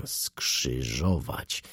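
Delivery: skrzyżować spoken very slowly with a lifting end; drawn-out key word. Acted. Lawyer presses his point on Wokulski, skrzyżować drawn out slowly. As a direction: stretch out skrzyżować so it sinks in.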